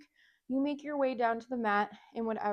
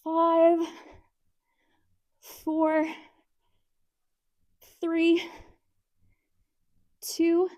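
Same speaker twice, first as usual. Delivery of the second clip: shaking with effort; 5 semitones higher, 5 dB louder, much slower than usual. Real, not acted. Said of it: "my legs are shaking".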